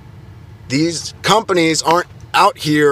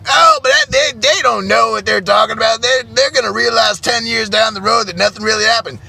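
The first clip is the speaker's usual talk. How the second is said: mock host dismissal; 6 semitones higher than usual. Mocking host dismissing millennials.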